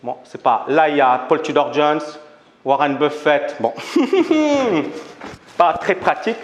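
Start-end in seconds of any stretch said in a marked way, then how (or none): 3.8-5.0 high, short laugh at the slide mix-up; embarrassed laugh. Real, not acted. A speaker laughing at the wrong slide to the audience, embarrassed laugh.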